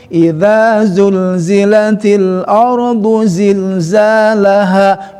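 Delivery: slow, flowing Quranic chant; connected recitation. Shown as a demonstration. Teacher reciting verse joined in flowing chant. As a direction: link the words in one slow chant. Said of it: "Itu mulai merangkai".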